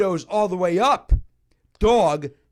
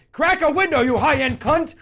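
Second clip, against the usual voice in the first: much higher, louder yell, breaking into a laugh; yelled insult; 11 semitones higher, 7 dB louder than usual. Retold or imitated. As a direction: bellow it across the restaurant. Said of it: "That's what I yelled".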